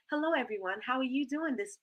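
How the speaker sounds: put-on polite presenter voice; stuffy self-introduction. Shown as a demonstration. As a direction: introduce yourself stiffly and quietly, not like yourself. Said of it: "You're not gonna sound like that".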